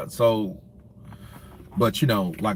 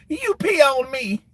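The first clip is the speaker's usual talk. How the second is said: much higher, slow indignant squeak; high whine; 13 semitones higher than usual. Retold or imitated. Katt's character after splash.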